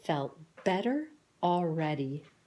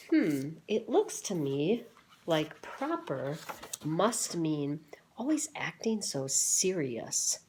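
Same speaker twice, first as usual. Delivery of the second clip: ordinary delivery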